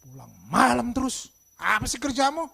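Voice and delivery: loud scolding parent; harsh, loud telling-off; anger character. Shown as a demonstration. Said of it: "Coba yang satu lagi".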